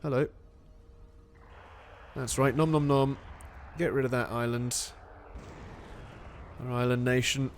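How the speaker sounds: ordinary delivery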